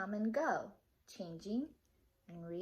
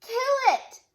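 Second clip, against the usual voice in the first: high, loud yell; shout; 14 dB louder than usual. Acted. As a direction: scream the order. Boy yelling to kill rat.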